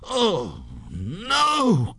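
high wail of dismay; panicked ghost crying out; horrified cry. A narrator's reading. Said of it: "cried the ghost".

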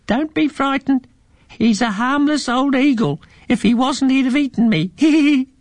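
very high, squeaky little mouse voice; a tiny pink mouse reassuring the children. A narrator's reading. The pink mouse reassures the frightened children, very high and squeaky.

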